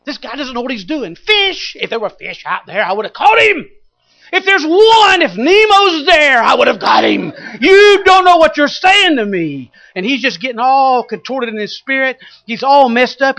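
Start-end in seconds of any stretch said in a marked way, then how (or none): 0.0-9.7 high, loud, angry yelling; furious. Acted. Peter yelling at Jesus that there are no fish, furious shout. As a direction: shout it, mad as a hornet. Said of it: "getting madder by the minute".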